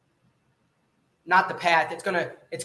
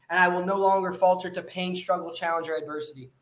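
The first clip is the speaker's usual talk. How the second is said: ordinary talk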